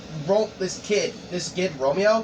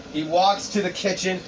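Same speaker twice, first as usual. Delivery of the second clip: ordinary delivery, nothing marked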